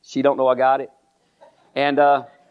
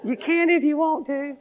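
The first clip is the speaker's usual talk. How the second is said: tiny teasing voice; little voice; 13 semitones higher than usual. Retold or imitated. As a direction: repeat it in a small coaxing voice. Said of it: "I heard this little voice".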